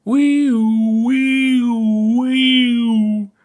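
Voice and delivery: high, loud mock siren wail; siren noise. Puppet show statue sets off an alarm, siren wail.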